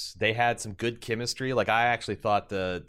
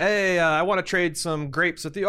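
mock polite trader; 8 semitones higher, 4 dB louder than usual. Acted diplomacy quarrel.

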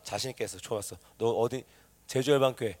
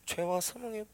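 soft, timid mumbled reading; half-hearted reciting; 14 dB quieter than usual. Shown as a demonstration. Preacher showing congregation how not to proclaim, half-hearted reciting. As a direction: recite it weakly with no conviction. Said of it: "이거 아니잖아".

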